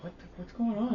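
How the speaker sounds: imitating dad woken up in a high voice; confused dad. Dad startled awake by mom, high mock voice.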